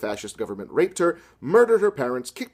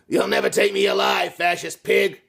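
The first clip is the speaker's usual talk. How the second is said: shouted; 5 semitones higher than usual. Acted. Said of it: "Robert shouted".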